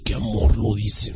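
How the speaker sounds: low, slow mock-swooning voice; moved listener. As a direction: imitate someone melting at the prayer. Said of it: "Y tú podías sentir".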